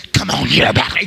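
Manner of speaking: low, strained mock altar urging; overzealous altar worker. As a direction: imitate someone pressing in from behind. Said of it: "somebody comes up behind you and says".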